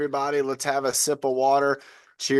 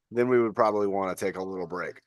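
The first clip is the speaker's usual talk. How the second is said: normal talking voice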